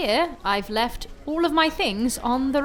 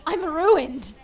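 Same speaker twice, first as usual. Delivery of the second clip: ordinary talk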